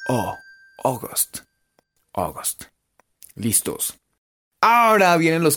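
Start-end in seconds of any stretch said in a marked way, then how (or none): 0.8-2.7 August repeated as "O-gost", dropping low; open O. Shown as a demonstration. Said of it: "suenan como una O abierta". (August con O abierta, "ógost")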